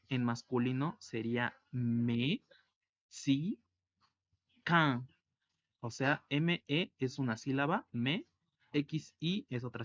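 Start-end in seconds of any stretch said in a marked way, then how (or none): 1.7-5.1 "me, si, can" split into three separate syllables; teacher dividing "mexicain" into syllables. Shown as a demonstration. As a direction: break "me, si, can" apart, pausing between syllables. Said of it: "si lo dividimos en sílabas".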